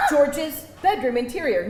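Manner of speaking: ordinary talk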